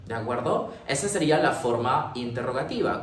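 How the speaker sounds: ordinary delivery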